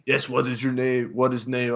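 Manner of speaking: put-on halting Russian-accented English; amateur mock Russian accent; imitated Russian accent. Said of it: "equally Russian, like, speaks only Russian".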